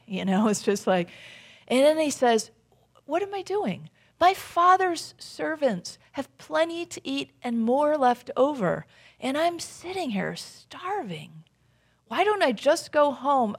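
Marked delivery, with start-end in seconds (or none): 3.0-13.6 higher-pitched, incredulous questioning; son's realization. Retold or imitated. Son talking to himself in the pigsty, incredulous. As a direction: wake up to your own folly, voice lifted.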